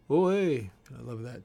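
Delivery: normal talking voice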